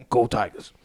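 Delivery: low, soft mock swamp-coach accent; Ed Orgeron's accent. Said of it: "Ed's actual accent".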